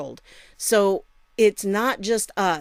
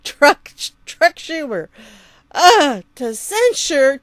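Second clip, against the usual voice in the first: breaking character giggling; 6 semitones higher, 8 dB louder, slower than usual. Real, not acted. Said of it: "I can't do it with a straight face now".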